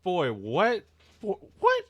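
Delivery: loud, high, stunned outburst; real shock. Real, not acted. Reader hit by a manga reveal, genuinely shocked. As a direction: blurt it out in rising disbelief.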